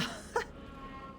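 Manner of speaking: short breathy burst of laughter; real laugh. Real, not acted. Streamer reacting to chat, real laugh. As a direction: break into a quick laugh. Loud laugh after saying no.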